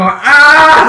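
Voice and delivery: a loud mock yell; imitated scream. Friends on the bus yelling, loud mock scream. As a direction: shout it like kids on the bus. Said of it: "we all go".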